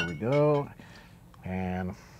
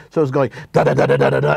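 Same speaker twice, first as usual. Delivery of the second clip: gunfire sounds; 6 dB louder, much faster than usual. Retold or imitated. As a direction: rattle it like an automatic rifle. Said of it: "she was holding it like this".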